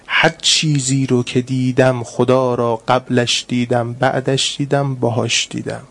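slow, low solemn quoting of the Imam; reverent recitation. Real, not acted. Preacher quoting the Imam to the congregation, slow and solemn.

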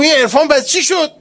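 high, loud scornful jab at the mystic; mocking rebuke. Pilgrim jeering at the mystic, high and loud. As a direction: demand it like an outraged passenger.

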